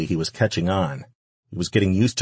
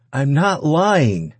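high, loud, drawn-out plea; desperate denial; 9 semitones higher, 5 dB louder, much slower than usual. A narrator's reading. Woman pleading with the man threatening her, desperate denial. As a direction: stretch the words out in desperation.

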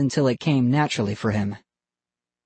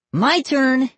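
put-on high-pitched kid's demand; insistent demand; 12 semitones higher, 4 dB louder than usual. A narrator's reading. Vinny grabs for the dummy and demands a turn, insistent. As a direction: claim it loudly and high.

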